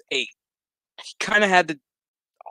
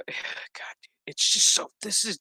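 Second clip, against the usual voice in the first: exasperated whisper; 4 semitones higher than usual. Real, not acted. Exasperated aside before the stupid part.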